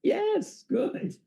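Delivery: teacher praising a pupil; teacher praise. Real, not acted. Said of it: "You really are a teacher".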